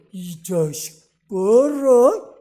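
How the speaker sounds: very slow, loud mock-stern warning. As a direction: stretch each word out as a father turning dead serious. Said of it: "막 정색을 하고". (정색한 아버지처럼 길게 끌며 크게 으르는 연기)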